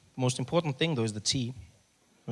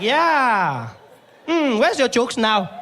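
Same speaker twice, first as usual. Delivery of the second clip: gloating clerk; 10 semitones higher, 8 dB louder, much slower than usual. Retold or imitated.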